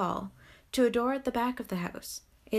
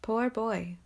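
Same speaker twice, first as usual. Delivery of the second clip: put-on understated pity for a remembered child. A narrator's reading. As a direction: say it with a touch of sorrow. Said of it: "in pity for his former self".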